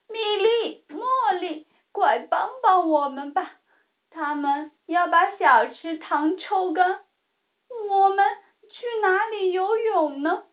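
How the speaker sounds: plead, a catch of crying at the end; sad plea. A narrator's reading. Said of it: "青蛙们伤心地说道". (带点哭腔的伤心恳求)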